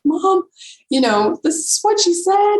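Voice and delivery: retelling an urgent, amazed call home; excited phone call. Retold or imitated. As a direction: pitch up high, eager to tell everything. Calling mom right after the message.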